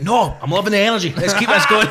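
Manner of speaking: high, loud imitation of an insistent host; insistent refusal. Acting out the insistent host refusing breaks.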